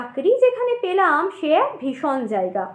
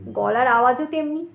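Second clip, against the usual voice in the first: usual speaking voice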